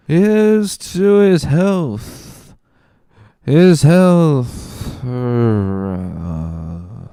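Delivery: put-on very high, slow, wobbling drunk outrage; a sloshed friend refusing, dragging every word; high drawn-out drunk indignation; drunken protest. Drunk Caderousse toasting again.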